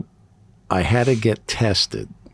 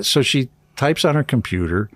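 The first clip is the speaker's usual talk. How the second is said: ordinary delivery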